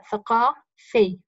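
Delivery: ثقافي very slowly, "thaqaa... fii"; ثقافي stretched and split to show the i after ف; kasra before final yāʾ. Shown as a demonstration. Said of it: "وكسرت ما قبل الياء".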